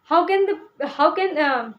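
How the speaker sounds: usual speaking voice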